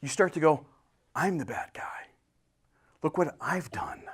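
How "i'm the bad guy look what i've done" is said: hushed, unvoiced admission of guilt; whispered confession. Preacher voicing a sinner's confession, whispered. Sinner admitting fault, imagined inner voice.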